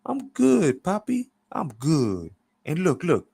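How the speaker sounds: high-pitched, chummy character voice; Clever. A narrator's reading. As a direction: reassure him with a high, eager lilt. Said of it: "Clever then says".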